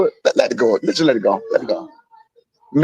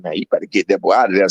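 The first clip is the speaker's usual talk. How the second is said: imitating the friend; 9 semitones lower, faster than usual. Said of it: "He was like".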